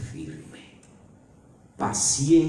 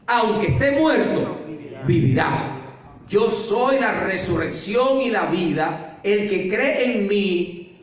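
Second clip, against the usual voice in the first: preaching; 7 semitones higher than usual. Acted. Preacher declaring Jesus' words to Marta, slow solemn preaching. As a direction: declaim Jesus' promise slowly and with weight.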